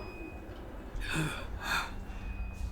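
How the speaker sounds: thin, airy gasping breaths; dying gasps. Acted. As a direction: gasp for air like a dying man. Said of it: "His breathing is shallow".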